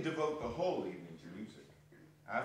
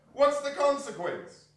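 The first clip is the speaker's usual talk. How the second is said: amused; 11 semitones higher, 7 dB louder than usual. Acted. Laughing rhetorical question.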